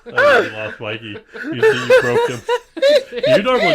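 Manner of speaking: high, loud, laughing teasing. Real, not acted. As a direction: laugh it out, delighted. Laughing at co-host.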